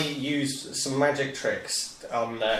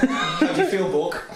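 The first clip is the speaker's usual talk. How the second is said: through a laugh, higher; amused, upbeat check-in; laughing question; 6 semitones higher, 3 dB louder than usual. Real, not acted. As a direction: giggle while asking a friend how he feels. Cheering up wounded friend, laughing.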